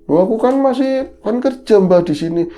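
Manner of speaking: put-on high, loud protesting boy; voicing the boy. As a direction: protest that he still works here. Boy protests in retold story. (anak laki-laki memprotes dengan suara tinggi)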